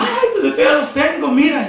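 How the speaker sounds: high-pitched imitated crying complaint; mock tearful. Preacher voices daughter crying to church brother, mock tearful. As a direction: imitate a daughter wailing in protest. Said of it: "se agarra llorando".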